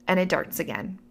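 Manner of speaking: ordinary talk, nothing marked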